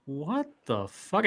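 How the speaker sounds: slow, hushed, drawn-out disbelief; bewildered muttering. Retold or imitated. Waking to yelling outside, quietly bewildered.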